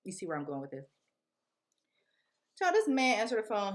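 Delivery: ordinary talk, nothing marked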